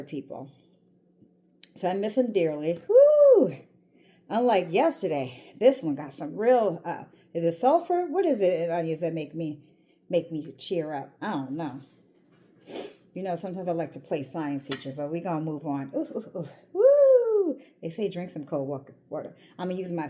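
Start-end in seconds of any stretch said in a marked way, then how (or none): 2.9-3.6 sudden high whoop as the onion stings; startled onion-fume whoop; woo from onion sting. Real, not acted. Onion stinging her eyes. 16.7-17.6 loud high woo breaking into a laugh; onion-fume woo with a laugh; laughing whoop. Real, not acted. Onion fumes again, laughing.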